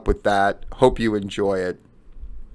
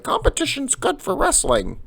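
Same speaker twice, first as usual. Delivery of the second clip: very high, softer mock voice; mocking parrot; 5 dB quieter than usual. Host mocking what wrestling people always say, in falsetto. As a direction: parrot the cliché in a squeaky falsetto.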